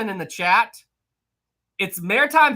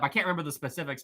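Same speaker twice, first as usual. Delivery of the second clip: ordinary talk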